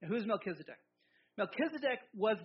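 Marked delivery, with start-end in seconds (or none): none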